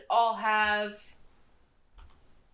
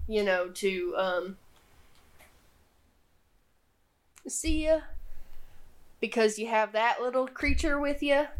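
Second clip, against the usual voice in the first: nervous clown. Acted. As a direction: stammer out an excuse, uneasy. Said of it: "looks nervous and goes".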